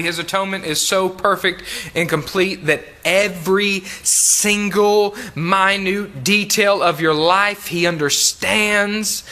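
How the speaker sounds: ordinary delivery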